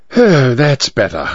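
slow, relieved exhale of words; weary relief. Acted. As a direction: sink into the chair with relief. Relieved sigh after sitting down.

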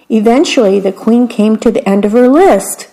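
usual speaking voice

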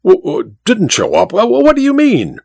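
stuttered question leaping high in pitch; stammering bewilderment. A narrator's reading. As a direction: stumble over the words in disbelief. Stunned listener stammers a question.

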